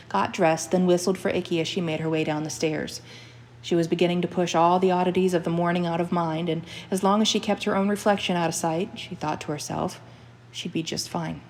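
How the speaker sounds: ordinary delivery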